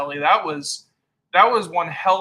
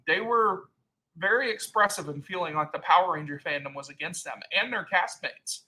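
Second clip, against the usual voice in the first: ordinary delivery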